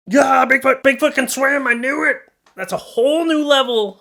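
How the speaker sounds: gleeful I-told-you-so squeal; excited Bigfoot cheer. Pretend. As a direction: shriek like a true believer vindicated. Excited jokey Bigfoot reveal.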